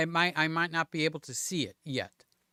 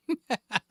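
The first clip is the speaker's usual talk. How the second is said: a short nervous burst of laughter; 6 semitones higher than usual. Real, not acted. Streamer laughing at himself while fumbling the software. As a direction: laugh it off, flustered. Laughing at his own nerves.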